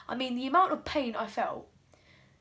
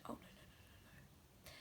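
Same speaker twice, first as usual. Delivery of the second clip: whispered dread; 27 dB quieter than usual. Acted. Ball hits fingers, dread.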